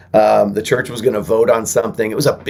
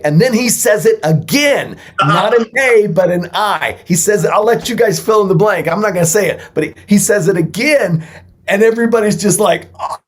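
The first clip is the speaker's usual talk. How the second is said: higher and louder, barely holding in laughter; excited retelling; 11 semitones higher, 4 dB louder than usual. Real, not acted. Guest telling the podcast host about the slip, excitedly. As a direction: tell it like the memory still cracks you up.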